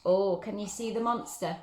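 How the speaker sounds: ordinary delivery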